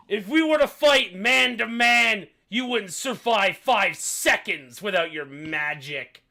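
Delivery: loud, high, drawn-out accusation; angry accusing king. Acted. Half-orc king blaming the half-elf queen, loud angry accusation. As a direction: hurl it across the table.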